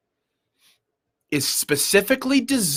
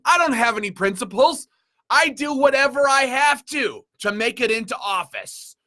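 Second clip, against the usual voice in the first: mock politician; 9 semitones higher than usual. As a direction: sneer it like a shameless politician who would sell out anyone. Mocking a politician's inner voice.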